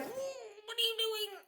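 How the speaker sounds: pulling back from a hug she didn't ask for; startled friend. Retold or imitated.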